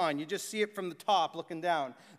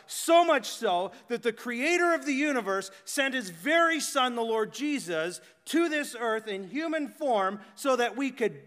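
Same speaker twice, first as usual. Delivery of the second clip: higher, louder preaching, stretched on the key words; fervent preaching; 4 semitones higher, 4 dB louder than usual. Acted. Preacher proclaiming the good news to the church, fervent. Preaching builds to full force.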